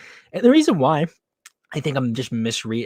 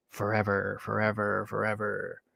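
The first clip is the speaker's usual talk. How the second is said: echoing fade; 3 semitones lower, 9 dB quieter than usual. Acted. Dramatic echo fading.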